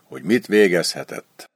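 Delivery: plain reading voice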